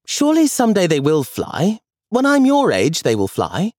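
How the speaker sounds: put-on high, hopeful little boy; boy insisting to his grandmother; child James. A narrator's reading. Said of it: "he said".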